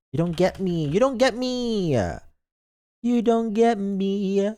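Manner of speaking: high, hammed-up whine; mock petulant. Good boy telling chat they don't get them, mock whining. Playfully protests being misunderstood.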